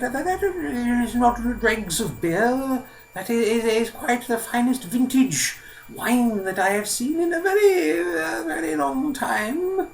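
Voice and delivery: put-on very high, slow, stammering elderly voice; old man, marvelling. Old man inspects the beer turned wine, high and marvelling. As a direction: draw the words out high and loud with wonder.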